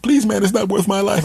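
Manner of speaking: high, loud squeaky begging; pleading squirrel. Acted. Squirrel pinned by the cat begging the man, squeaky plea. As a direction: beg for your life as a cartoon squirrel.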